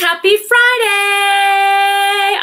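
bright, drawn-out happy Friday call; excited greeting. Real, not acted. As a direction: greet the viewers with big energy. Live stream greeting to viewers.

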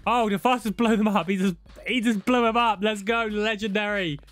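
high, hyped celebration; excited cheering. Real, not acted. Gamer celebrating mate blowing mutants up, excited. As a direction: hype up your mate, pitched high.

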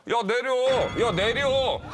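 high, loud mock roadside yelling; angry shout. Senior confronts rude driver, angry shouting. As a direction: imitate ordering someone out in fury. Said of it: "화가 나니까".

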